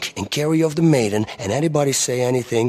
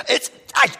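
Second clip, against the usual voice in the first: ordinary talk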